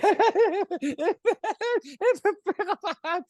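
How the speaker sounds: high manic Joker cackle; Joker laugh. Real, not acted. Laughing at himself over the fight, manic Joker cackle. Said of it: "laughing and crying like the Joker".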